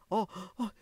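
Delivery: short, high shocked "ah, ah"; acted gasps of shock; startled gasps. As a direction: gasp twice as if suddenly spooked.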